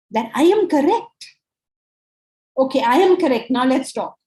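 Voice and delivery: a hint of a smug student; mock certainty. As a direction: parody a know-it-all insisting. Mocking the arrogant know-it-all.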